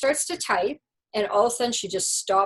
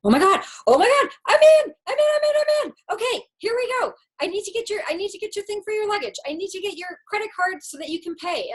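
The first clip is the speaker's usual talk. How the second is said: much higher, rapid, breathless rush; frantic excitement; 10 semitones higher, faster than usual. Retold or imitated. Agent finally logged in, rushing to finish, high and fast. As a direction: tumble the words out in relief. Agent suddenly gets into system.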